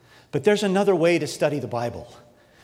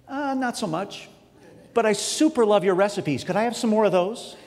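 higher, awkward mock answer; sheepish fan reply; 6 semitones higher than usual. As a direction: fumble the invitation. Pretend reply to Oprah.

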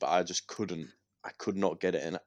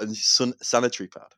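ordinary talk

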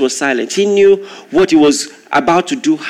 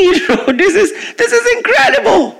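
laughing disbelief. Real, not acted. Astonished at the crowd.

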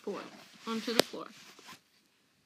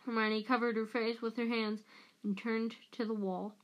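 ordinary delivery

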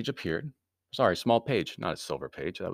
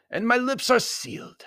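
ordinary delivery, nothing marked

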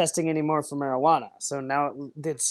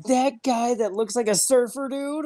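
higher-pitched, disbelieving question; incredulous quote; 7 semitones higher than usual. Retold or imitated. Retelling friend's reaction to the surfer dude, incredulous. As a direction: imitate a friend's baffled reaction.

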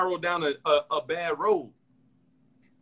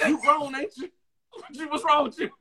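high, shrill-free yelling mother; angry mom scolding; 11 semitones higher than usual. Retold or imitated. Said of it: "She's like".

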